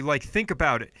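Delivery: usual speaking voice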